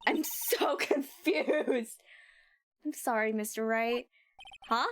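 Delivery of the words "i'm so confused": laughing. Real, not acted. Laughing at the game.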